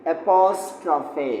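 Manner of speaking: एपोस्ट्रॉफे said slowly, syllable by syllable, ending in a clear "e"; careful pronunciation. Shown as a demonstration. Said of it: "इस comma को कहते हैं". (एपोस्ट्रॉफे को धीरे-धीरे, आख़िर में "फे" बोलें)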